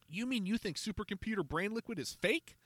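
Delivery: put-on a kid who just realised he was fooled; startled boy. A narrator's reading.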